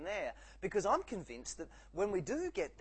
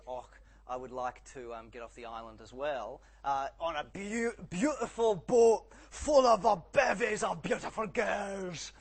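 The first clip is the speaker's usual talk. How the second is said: hammed-up Scottish burr, louder and slower as it builds; Scottish accent; 3 semitones higher, 11 dB louder, slower than usual. Preacher jokes as the Scotsman making his wish, Scottish accent. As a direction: put on a broad, drawn-out Scottish accent. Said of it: "insert Scottish accent here".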